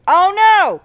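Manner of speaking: high, loud cry of dismay; alarmed outcry. Real, not acted. Something goes wrong in game.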